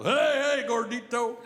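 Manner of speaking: Texan heckling a big guy; mocking call-out. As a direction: taunt the fat guy from across the way. Texan calling out to him.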